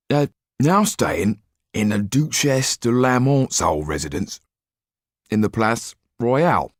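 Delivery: high, halting talk through food; mouth full. A narrator's reading. Didier reports on the Marquis while eating, mouth full. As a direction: talk round a big mouthful of bread. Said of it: "started to speak with his mouth full".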